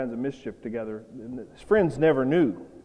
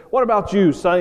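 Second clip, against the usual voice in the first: louder, higher mock questioning; acted, raised-voice challenge; frustrated officer; 5 semitones higher, 7 dB louder than usual. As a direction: demand it like an exasperated officer. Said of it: "the officer was frustrated".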